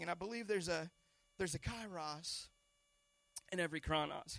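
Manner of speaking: ordinary delivery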